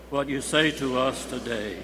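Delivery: ordinary talk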